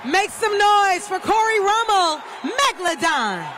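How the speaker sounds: dueling hype announcers. Real, not acted. Arena announcer hyping the crowd.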